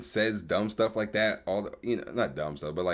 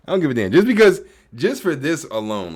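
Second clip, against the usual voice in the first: angry outburst; 7 semitones higher, 10 dB louder than usual. Real, not acted. Angry rant at streamer.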